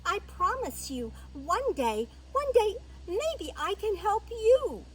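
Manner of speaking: high, loud, eager mouse voice; breathless-with-relief mouse promise; grateful little mouse. Acted. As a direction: gush thanks in a high little voice.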